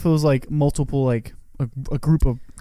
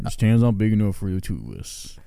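put-on cowboy accent; 5 semitones lower than usual. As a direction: play a cowboy in a showdown, voice dropped. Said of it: "like, cowboy accent".

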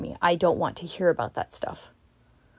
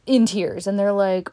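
ordinary talk, nothing marked

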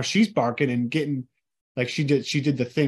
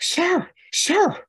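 high, far-off imitation of mom calling sir; a much higher mock mom voice calling after the stranger; imitating mom; 3 dB louder than usual. Son mimics his anxious mom calling to a trespasser, high imitation. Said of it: "this is how she sounds, legitimately".

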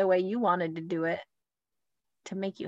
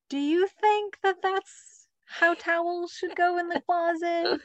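sweet teacherly question, higher; high mock-patient parent voice; 9 semitones higher, slower than usual. Mom asks kids about towels, sweet high voice. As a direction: pitch it high, asking kids gently. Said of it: "you're like".

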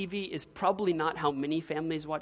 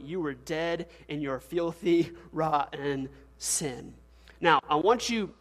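normal talking voice

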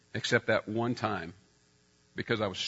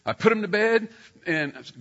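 normal talking voice

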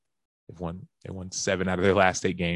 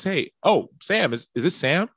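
surprised friend; 9 semitones higher than usual. Retold or imitated. Friend's unsure pocket-dial greeting.

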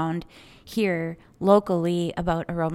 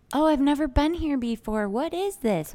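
higher-pitched, lightly imitated customer; receptive customer; 6 semitones higher than usual. Retelling a new customer at the shop, receptive. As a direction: lightly voice a curious newcomer. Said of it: "super nice, super receptive lady came into my shop".

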